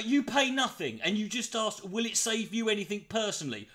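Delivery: normal talking voice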